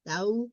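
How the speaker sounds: "Thou" with the oo at the end brought out, rising; oo ending stressed. Shown as a demonstration. Teacher pointing out the oo ending to students. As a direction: lean on the oo in "Thou". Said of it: "You hear the oo at the end?".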